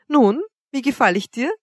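mocking. A narrator's reading. Woman showing off her fur jacket, teasingly. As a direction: ask it with a taunting lilt. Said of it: "die Brauen spöttisch zusammenziehend".

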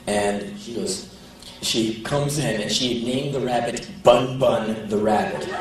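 ordinary talk, nothing marked

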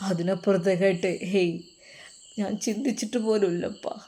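soft, halting confession; heartbroken son. Acted. Son admitting only one face is in his heart, heartbroken. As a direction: confide quietly, pausing between phrases.

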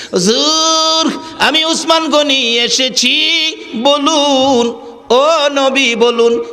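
high, long-held chanted call; impassioned calling out. Acted. Rich Usman calls to the weeping Prophet, impassioned high call. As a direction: call out high, stretching each phrase end.

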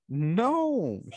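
high-pitched, mild story-time shout; mock shout. A narrator's reading. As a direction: draw out a high protest without really shouting. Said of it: "shouted Mouse".

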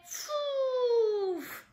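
big puff of wind; blowing gust. Acted. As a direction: blow the house down with one breath. Wolf blows the house down.

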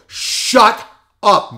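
loud, high shout to silence someone; re-enacted full-voice yell. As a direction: cut him off at full voice. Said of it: "at my full voice".

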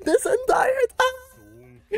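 high, tearful, laughing proclamation; real choked-up fan hype; emotional excitement. Real, not acted. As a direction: announce it with joyful tears. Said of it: "I'm actually getting emotional".

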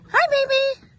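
high, loud coo at the hen; sweet squeaky greeting for a pet. Real, not acted. Greeting her pet hen in high baby talk.

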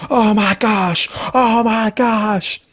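stunned amazement at the move; awed. Real, not acted. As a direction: marvel at it, drawn out.

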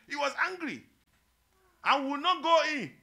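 high, loud indignant refusal; angry older son. Acted. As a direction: shout it like a stubborn, offended son.

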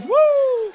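high, loud "woo"; long high-pitched cheer; party whoop. Acted. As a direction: let out a gleeful party whoop. Acts happy man partying in town.

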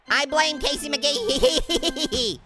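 slow McGee trailing into hee hee; giggled name. Pretend. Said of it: "Emphasis on the gee hee".

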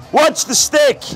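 ordinary talk, nothing marked

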